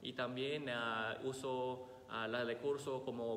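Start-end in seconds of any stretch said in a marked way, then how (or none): none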